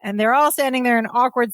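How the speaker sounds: usual speaking voice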